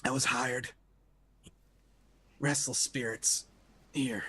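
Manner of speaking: very quiet, halting fragments with long pauses; weak wounded man, a few words per breath; pained, short of breath. Acted. Wounded exorcist confesses from the beginning, pained and halting. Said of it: "pained and short of breath".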